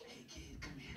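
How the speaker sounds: very soft whispered call; furtive beckoning. Retold or imitated. Bully luring a kid over to trick him, furtive whisper. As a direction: lure the kid over under your breath.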